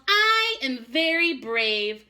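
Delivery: mild yell of a proud little raccoon. Acted. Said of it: "and yelled".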